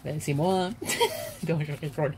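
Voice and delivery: said through a laugh; giggling, half-laughed retraction. Real, not acted. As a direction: laugh while taking the joke back. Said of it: "je ris parce que".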